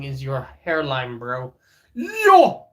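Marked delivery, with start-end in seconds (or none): 1.9-2.7 high, loud startled yelp; sudden high-pitched shout at a roast; outraged yell. Real, not acted. Mock outrage at hairline roast.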